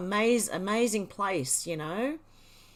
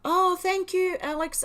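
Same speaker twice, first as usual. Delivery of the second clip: high, loud gush of real delight; excited thanks; 9 semitones higher, 5 dB louder than usual. Real, not acted. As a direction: squeal your thanks, caught off guard. Donation thanks during livestream.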